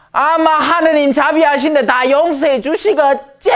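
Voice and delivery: high, put-on presuming voice; clueless believer. Clueless believer assuming God forgives everything, high mock voice. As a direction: mimic naive confidence, pitched well up. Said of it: "이래 얘기합니다".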